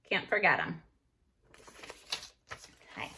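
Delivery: normal talking voice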